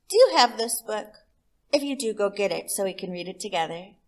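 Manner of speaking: put-on reading to children; cheerful invitation to fetch the book. Storyteller inviting a child to read along, bright. Reader addresses young listeners.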